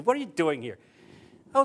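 ordinary talk, nothing marked

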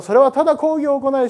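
usual speaking voice

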